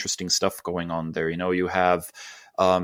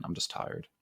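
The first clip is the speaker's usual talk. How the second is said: whispered, much softer and lower; real tired whisper; 12 semitones lower, 14 dB quieter than usual. Real, not acted. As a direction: let it fade into a breathy whisper of fatigue. Repeated tired confession, whispered.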